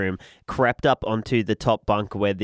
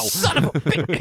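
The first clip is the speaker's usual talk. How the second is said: mock pained curse; 8 semitones higher than usual. Quoting the cornballer burn.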